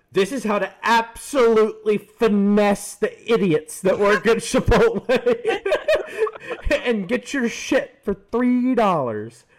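"This is how" in a high, loud put-on video voice, laughing midway; TikTok narrator voice. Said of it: "and it goes".

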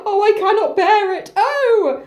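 high, hammed-up wail; anguished lament. Oedipus as guards take his daughter, high mock lament.

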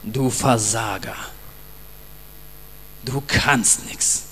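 low, slow, sneering voice of doubt; accusing inner voice. Retold or imitated. Preacher voicing the condemning voice, low and slow sneer. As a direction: drag it down low like a tempter's taunt. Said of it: "Er ist sofort zur Stelle".

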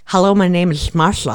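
slushy side-air s in "Marsha"; lateral lisp. Shown as a demonstration. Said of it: "It's coming out the side".

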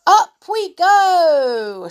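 high, loud, long-drawn call; jubilant shout. A narrator's reading. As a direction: shout it out, stretching the words. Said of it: "shouted Joe".

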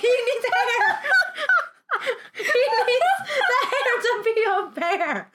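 high giggly voice through laughter; laughing. Retold or imitated. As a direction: squeak it out while laughing. Laughing while quoting herself.

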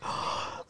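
imitated sharp breathless gasp; acting out his surprised gasp at the door; mock gasp. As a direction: gasp in as if stunned by the sight. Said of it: "And he's just like".

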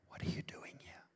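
God's still small voice, unvoiced whisper. God speaking in a still small voice, whispered. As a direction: breathe the question barely aloud. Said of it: "God goes".

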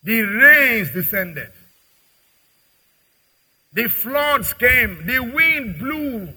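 slow, loud, dramatic scripture lines; thundering proclamation. Real, not acted. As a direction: boom it out like the storm itself. Preacher dramatising the parable.

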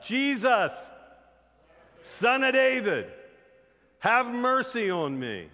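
high, drawn-out shout across a crowd; crying out. Retold or imitated. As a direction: shout slowly and loudly for mercy. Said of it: "Bartimaeus starts crying out".

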